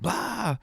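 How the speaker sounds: high "blah!" burst; spring out with a mock-scary cry; playful boo cry. Jumping from behind the couch at toddler, playful high cry.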